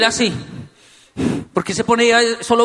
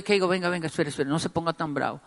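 much softer, lower, quick placating words; hushed soothing; 3 semitones lower, 9 dB quieter, faster than usual. Retold or imitated. Dad placating his cross son, retold hushed soothing. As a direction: talk him down under your breath.

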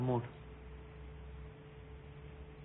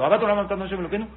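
"Ahavat Olam Ahavtam Hashem Elokeinu" rattled off very fast; rushed prayer; 2 semitones higher, 5 dB louder, much faster than usual. Shown as a demonstration. Preacher showing how people rush the prayer, very fast. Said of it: "decimos el Ahavat Olam Ahavtam rápido".

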